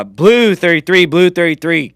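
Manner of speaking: loud, drawn-out quarterback cadence; calling a play. Acted. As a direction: shout the signals down the line.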